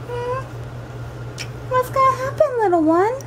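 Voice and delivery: high cooing voice for a cat. Real, not acted. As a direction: coo it sweetly at the kitten. Baby talk to cat Zoe.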